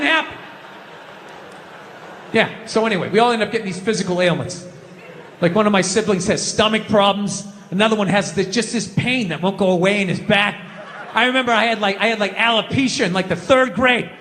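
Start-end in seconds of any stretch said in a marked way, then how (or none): none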